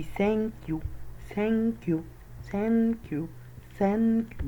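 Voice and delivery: "ثانك يو" high, long open a, falling "يو"; vowel stretched downward. Shown as a demonstration. Teacher repeating for learners, "ثانك يو" high with stretched a. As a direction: hold "ثانك" high with the jaw dropped. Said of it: "بمد لتحت".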